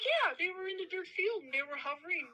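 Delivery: put-on eager kid. Kids eagerly explaining to mom.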